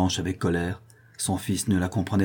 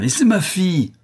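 high, loud disgusted cry; exasperated outcry; 10 semitones higher, 5 dB louder than usual. A narrator's reading. Widow disowning her weak daughter, exasperated outcry. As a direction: exclaim in outrage. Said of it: "la veuve exaspérée".

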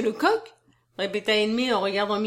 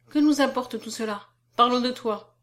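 ordinary delivery

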